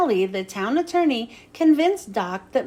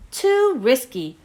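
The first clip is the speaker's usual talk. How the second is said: ordinary delivery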